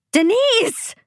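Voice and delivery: very high desperate scream. A narrator's reading. Parent's last call to kidnapped daughter, screaming. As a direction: scream the name desperately, pitch at its peak. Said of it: "I scream one more time".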